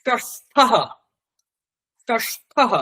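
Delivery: ষষ্ঠঃ with the tongue curled back and a final h; retroflex s. Shown as a demonstration. Teacher demonstrating the retroflex s for students. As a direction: hit ষষ্ঠঃ with a hard retroflex sh. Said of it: "মূর্ধন্য স দিয়ে করেন".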